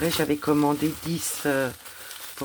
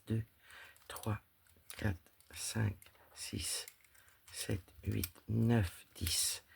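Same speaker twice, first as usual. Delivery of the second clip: counting under her breath; 2 semitones lower, 7 dB quieter, much slower than usual. Real, not acted. Counting items softly to herself.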